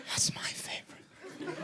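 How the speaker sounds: breathless, high whisper. Acted. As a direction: hush it out in awe. Spider whispering in awe.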